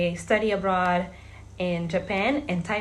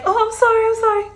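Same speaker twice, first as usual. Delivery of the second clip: much higher, loud mock apology of the lady; flustered apology; 14 semitones higher, 7 dB louder, much faster than usual. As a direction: squeak out a hurried sorry as the lady. Lady apologizing after pulling hair.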